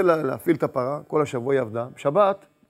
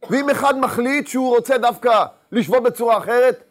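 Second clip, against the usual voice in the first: a rabbi preaching to his congregation, rising with fervour; impassioned preaching; 6 semitones higher, 5 dB louder than usual. Acted. Sermon pitched up in fervour, big and loud.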